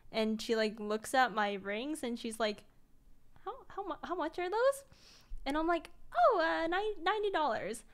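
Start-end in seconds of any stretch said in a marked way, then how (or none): 3.4-4.8 high, halting friend's question; mildly taken aback, stammering into the question; hesitant questioning. Retold or imitated. Friend questions the ring price. 6.2-7.8 high, stammered confession; mock-sheepish, pitched up; sheepish admission. Sheepishly admits the price.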